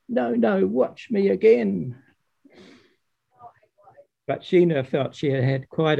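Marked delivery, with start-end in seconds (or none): 0.1-2.0 Father Frost correcting. Acted. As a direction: stop her and show again. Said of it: "he continued".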